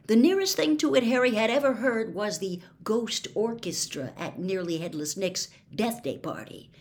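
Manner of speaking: usual speaking voice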